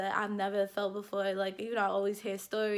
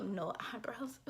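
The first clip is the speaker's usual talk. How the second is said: embarrassed giggle; 2 semitones higher, 8 dB quieter than usual. Real, not acted. Admitting to viewers she has no eyebrows, hushed giggle.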